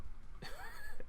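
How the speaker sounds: a quick high burst of laughter; short laugh. Real, not acted. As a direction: laugh it off after the rant. Laughing at his own rant.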